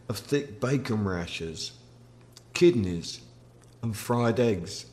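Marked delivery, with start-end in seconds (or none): none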